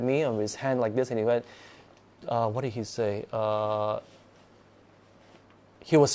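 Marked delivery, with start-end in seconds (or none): none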